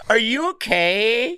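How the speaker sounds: high, slow, drawn-out "are you okay"; mock concerned girl. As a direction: stretch it out like a pitying girl. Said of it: "No, they'd be like".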